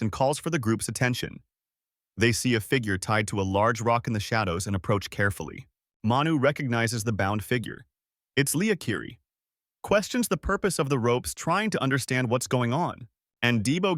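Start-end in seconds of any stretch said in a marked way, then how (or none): none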